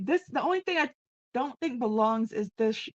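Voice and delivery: usual speaking voice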